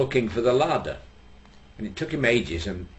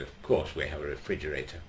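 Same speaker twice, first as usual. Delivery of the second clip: annoyed snob; 6 semitones lower, 9 dB quieter than usual. Acted. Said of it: "looks rather annoyed".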